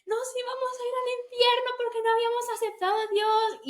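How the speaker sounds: high-pitched exaggerated crying wail; mock wailing. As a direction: wail it like a hammed-up tearful panic. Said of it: "así llorando las dos".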